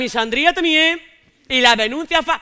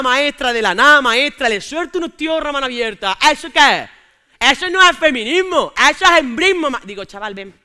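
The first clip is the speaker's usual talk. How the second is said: ordinary talk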